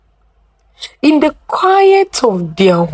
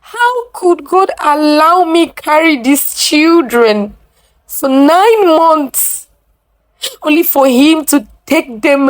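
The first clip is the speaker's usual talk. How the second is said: higher, aching lament to God; grieving plea; 7 semitones higher than usual. A narrator's reading. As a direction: pour out a mother's grief. Grieving mother questions God.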